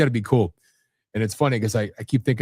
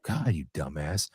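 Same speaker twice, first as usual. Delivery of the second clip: a low, slow, grumbled mock insult; 8 semitones lower, 8 dB quieter, slower than usual. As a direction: growl the insult slowly under your breath, half joking. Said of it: "You know, it's like".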